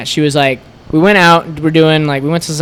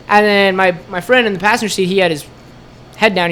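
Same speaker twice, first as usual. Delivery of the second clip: ordinary talk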